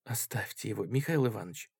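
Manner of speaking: very soft, hushed voice; whispered plea. A narrator's reading. Sickly lady begs Artskhanov to leave it, whispering. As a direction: beg him under your breath. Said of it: "шептала болезненная дама".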